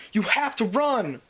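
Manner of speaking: urgent cry for his friends to flee; urgent shout. A narrator's reading. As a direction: yell at them to run. Spike urging friends to flee.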